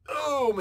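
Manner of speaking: loud, high impact noise; imitated punch. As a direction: blast out a punch sound effect.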